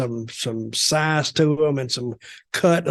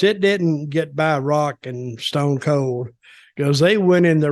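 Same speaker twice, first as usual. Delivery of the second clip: ordinary talk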